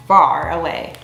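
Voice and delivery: usual speaking voice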